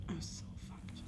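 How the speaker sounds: whispered despair; panicked whisper. Real, not acted. Player caught by the monster, whispered despair. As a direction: breathe it out under your breath.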